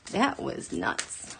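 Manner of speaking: slow hushed disbelief; reacting to the news in a hush; whispered aside. Real, not acted. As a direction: breathe it out, stunned.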